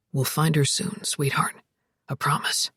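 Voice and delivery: low, soft man's promise; deep voice dropping to a soft promise; tender reassurance. A narrator's reading. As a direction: soothe her deeply and gently. Alex tenderly promises Zoe.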